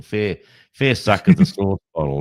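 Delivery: hammed-up Aussie scoffing at a tall tale; mock Aussie scoff. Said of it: "you go".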